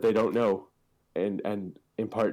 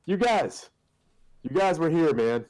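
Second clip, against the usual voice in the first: Patton Oswalt impression; 8 semitones higher, 4 dB louder than usual. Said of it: "he's just like".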